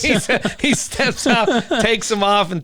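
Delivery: can barely get the story out for laughing; giggling while telling a friend the story; laughing through it. Real, not acted.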